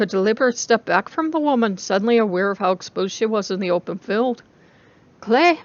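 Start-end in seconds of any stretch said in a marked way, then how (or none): 5.2-5.6 high urgent cry of a name; alarmed call. Acted. Calling out in alarm.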